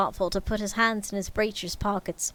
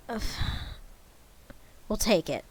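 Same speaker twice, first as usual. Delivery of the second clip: plain reading voice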